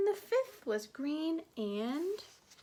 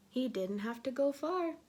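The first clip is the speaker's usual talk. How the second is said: ordinary delivery, nothing marked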